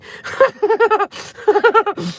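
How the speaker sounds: high, embarrassed laugh through tears; teary laugh. Real, not acted. Laughing at herself for crying in front of viewers. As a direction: laugh it off while still crying.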